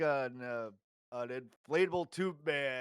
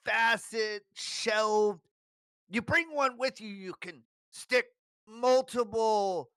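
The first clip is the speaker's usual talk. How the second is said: higher, pushy sales patter; eager salesman; 5 semitones higher, 6 dB louder, slower than usual. Acted. Shopkeeper talks up coffins to the customer, higher and pushy. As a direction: talk up the wares to close the deal.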